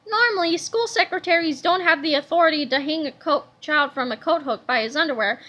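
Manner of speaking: plain reading voice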